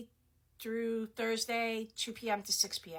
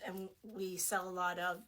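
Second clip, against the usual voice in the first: ordinary talk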